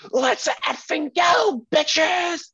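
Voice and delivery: very high, loud whooping yell, slowed and drawn out; hyped-up fan shout. Retold or imitated. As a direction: scream it to pump up the whole section. Bandana fan hyping the crowd.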